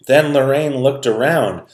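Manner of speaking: plain reading voice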